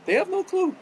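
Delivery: sudden high, loud cry of frustration; exasperated outburst. Real, not acted. Ranting to listeners, a loud exasperated cry. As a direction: blurt it out, fed up. Rant about people being clueless.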